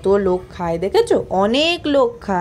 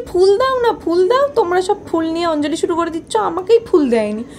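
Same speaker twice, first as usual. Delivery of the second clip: high pleading; 7 semitones higher than usual. Retold or imitated. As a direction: beg for the flowers like a fussing child. Retelling pleading for flowers. (উঁচু গলায় অনুনয়)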